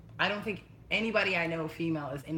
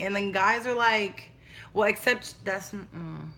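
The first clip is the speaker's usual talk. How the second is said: ordinary talk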